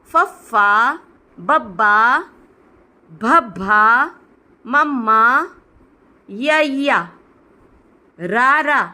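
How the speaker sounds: each letter name called out clearly with a pause; alphabet recitation. Shown as a demonstration. Teacher reads Punjabi letters aloud, a slow measured drill. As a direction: recite the letters slowly and distinctly for learners.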